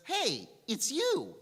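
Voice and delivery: high, loud, drawn-out delighted hail; acted squeal of recognition; excited greeting. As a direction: call out in happy recognition. Teenager hails doctor in hospital corridor.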